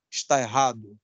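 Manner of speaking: normal talking voice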